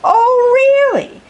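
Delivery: high, loud, scornful dragon voice; fiery dragon librarian. Acted. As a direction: snap it back like a proud dragon lady. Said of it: "asked Miss Scales with a glare".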